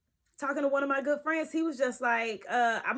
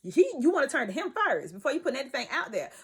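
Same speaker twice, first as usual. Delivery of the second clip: normal talking voice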